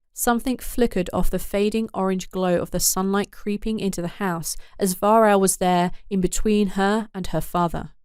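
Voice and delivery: ordinary delivery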